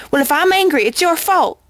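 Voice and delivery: put-on old pony snapping back, stung and defensive. A narrator's reading. Old pony snaps at the unicorn, blaming her. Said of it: "Granny Smith snaps".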